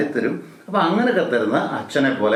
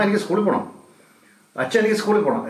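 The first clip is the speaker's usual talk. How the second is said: put-on higher, eager little child begging; 6 semitones higher, faster than usual. Child asking mother and father to go to school, eager. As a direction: play the child pleading to go to school.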